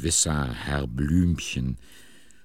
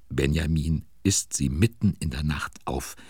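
ordinary talk, nothing marked

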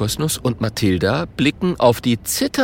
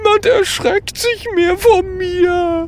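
high, slow, sobbing wail; pitiful crying; 4 dB louder than usual. A narrator's reading. Ghost lamenting that nobody fears it, pitiful crying. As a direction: bawl it out like a miserable ghost. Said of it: "fängt es jämmerlich an zu weinen".

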